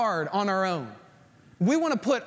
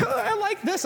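mimicking wife; 10 semitones higher than usual. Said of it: "and they're like".

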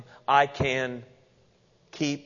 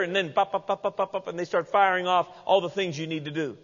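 normal talking voice

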